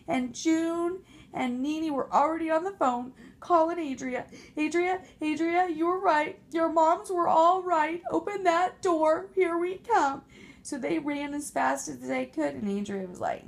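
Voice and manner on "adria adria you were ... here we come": higher, louder urgent plea; urgent call. Acted. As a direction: beg Adria to open up. Pigs calling for help on phone.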